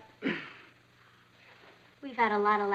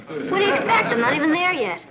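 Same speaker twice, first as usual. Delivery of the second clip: indignant; 5 semitones higher, 5 dB louder than usual. Acted. Mother defends her poor accent.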